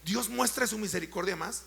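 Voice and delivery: loud chanting sermon, each question hammered; preaching at full force. Real, not acted. Pastor to his congregation, loud chanted preaching. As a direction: call out every question to the crowd, rising and loud.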